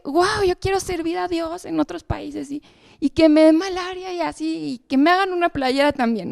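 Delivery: high, breathless dreamy wish; eager teen girl. Retold or imitated. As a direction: gush like a thrilled young girl. Retelling her eager teenage wish.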